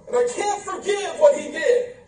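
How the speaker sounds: put-on pitched high, whining over an old hurt; mock hurt voice. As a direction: play the wounded child, high and pouting. Mocking the hurt churchgoer.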